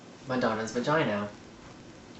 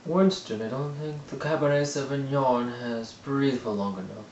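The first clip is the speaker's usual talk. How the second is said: yuppie person voice; 3 semitones higher than usual. Retold or imitated. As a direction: put on a smug country-club yuppie. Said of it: "they say things like".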